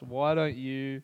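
usual speaking voice

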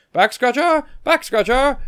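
high-pitched goofy waiter call; hammed-up, loud, high imitation of a waiter; wacky waiter. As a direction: sing out the gag to the kitchen. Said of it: "and's like".

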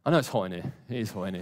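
normal talking voice

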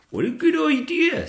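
high, loud keen little dragon; eager young dragon. Acted. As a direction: jump at the offer as a young dragon. Zog accepts eagerly.

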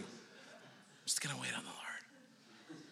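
slow mock emo whisper. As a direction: whisper it like a moody teen. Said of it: "And he's like".

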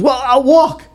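high, huffy retort; lightly voiced angry Beetlejuice; mock angry little man. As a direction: voice him storming off in a huff. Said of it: "He goes".

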